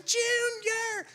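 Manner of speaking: high mock yell of a fan; kid's shout. Fan at the gate calling out, high mock shout. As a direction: shout his name like a hopeful kid. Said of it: "You're shouting".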